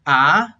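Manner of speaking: "ah" climbing from low, loud; rising tone. Shown as a demonstration. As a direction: glide upward through "ah". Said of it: "second tone is a rising tone".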